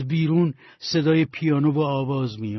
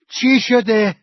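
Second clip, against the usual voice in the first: much higher and louder, startled; alarmed question; 9 semitones higher, 8 dB louder, slower than usual. Acted. Wife hearing the nightstand fall, alarmed. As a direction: burst in worried at the noise.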